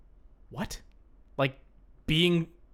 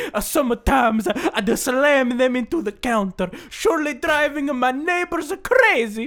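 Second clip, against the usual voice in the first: put-on Italian accent; 8 semitones higher than usual. Reading the ice tray review in an Italian accent. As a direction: keep going as an old Italian man. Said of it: "very impressive Italian accent".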